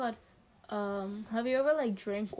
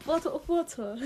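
playful British-style bottle of water; lightly put-on British accent; 3 semitones higher than usual. Shown as a demonstration. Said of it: "the way I say water bottles".

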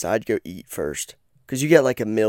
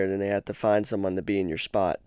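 ordinary talk, nothing marked

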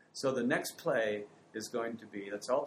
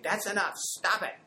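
raised, scolding parent; sharp scold; 8 semitones higher, 5 dB louder than usual. Retold or imitated. Parent scolding a sticky crying child, louder sharp scold. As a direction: snap at the child to stop. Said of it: "rather than saying".

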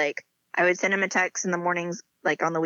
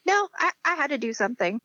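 higher-pitched insistent protest; defensive protest; 8 semitones higher than usual. Retold or imitated. Bride defending herself to her fiance, defensive. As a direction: protest like you were caught out.

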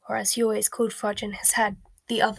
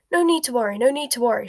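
raised-voice reassurance in a fluster; shouting; 3 semitones higher, 7 dB louder, faster than usual. A narrator's reading. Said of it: "shouted Fudge".

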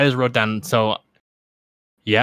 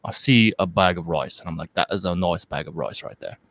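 lower, lightly London Michael Caine; Michael Caine impression; 5 semitones lower than usual. Showing his friend a lower Michael Caine in a faint London accent. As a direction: drop the voice low for a mild Caine. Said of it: "a little bit lower on Michael Caine".

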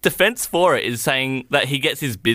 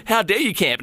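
mock indignant gamer; 9 semitones higher than usual. Gamer swearing at players on the screen, mildly acted outrage. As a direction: play up mild indignation at a cheat. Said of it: "swearing at the people on the screen going".

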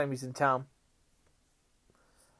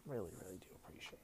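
fast, soft breathy whisper of gratitude; whispered thanks; 5 semitones lower, 17 dB quieter, much faster than usual. Real, not acted. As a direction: whisper it quickly, heartfelt. Heartfelt thanks trailing into whisper.

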